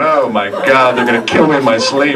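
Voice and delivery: loud, high comic alarm; hammed-up panicked inner voice; mock panic. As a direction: play up the dread for laughs. Retelling his panicked thought.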